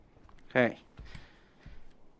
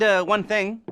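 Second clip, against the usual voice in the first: ordinary talk, nothing marked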